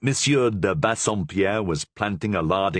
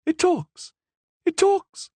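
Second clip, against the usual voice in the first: much higher, thrilled squeal; delighted; 3 dB louder, slower than usual. Acted. Queen discovering the parrot talks, excited high squeal. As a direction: gasp it out like a child with a new toy. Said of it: "said the Queen".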